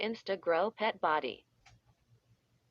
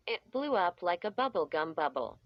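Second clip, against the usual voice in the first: plain reading voice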